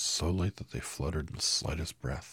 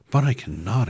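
much higher, loud startled cry; girl exclaiming in shock at the task; surprised exclamation; 8 semitones higher, 13 dB louder than usual. Acted. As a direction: blurt it out in shock. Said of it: "exclaimed Dorothy, greatly surprised".

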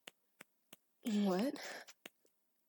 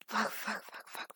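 usual speaking voice

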